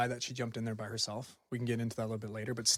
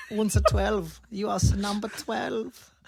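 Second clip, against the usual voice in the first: mock German threat; 8 semitones higher, 5 dB louder, slower than usual. Menacing German killer bit.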